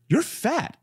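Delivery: high, blunt mock-shocked verdict; comic appalled exclamation; retold shock. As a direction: blurt it high in imitated disbelief.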